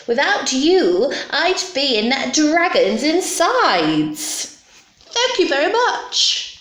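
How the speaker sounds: high-pitched, effusive gratitude; grateful witch. Acted. Witch saved from the dragon's insides, high gushing voice. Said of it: "grateful witch cried".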